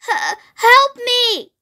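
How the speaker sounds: high, drawn-out stammered plea; stammer, then wail for help; distressed storybook dragon begging; pleading dragon. A narrator's reading. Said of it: "it pleaded".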